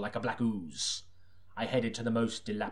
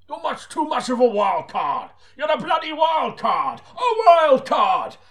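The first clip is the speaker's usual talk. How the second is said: high, slow, roaring yell, building louder; furious shout; 14 semitones higher, 11 dB louder, slower than usual. Acted. Chief constable yells at Seaton that he's a wild card. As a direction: scream it, slower and louder on each line. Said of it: "he bellowed".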